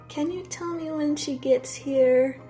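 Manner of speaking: high, slow, sleepy and dreamy; woozy, barely awake asking; drugged loopy voice. Retold or imitated. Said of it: "And then she's like".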